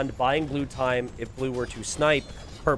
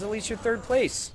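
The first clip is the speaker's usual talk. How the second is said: mock taunting voice; 8 semitones higher than usual. Streamer voices purple's chat message.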